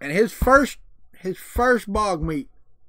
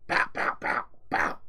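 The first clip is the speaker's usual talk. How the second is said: short pow-pow gun noises; mock gunshots; 13 semitones lower, 4 dB quieter than usual. Shooting visitors at the table with a rubber band gun, playful gunshot noises. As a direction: fire off playful little pows.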